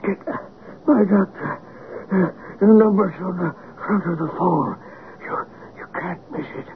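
gasping for air during heart attack; struggling to breathe, faltering; out of breath. Acted. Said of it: "I can't get my breath".

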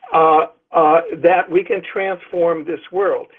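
ordinary talk, nothing marked

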